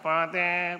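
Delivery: put-on voice, held out on one note; disguised voice. Penitent hiding identity from a priest who knows them, disguised voice. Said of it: "iniiba nyo yung boses nyo".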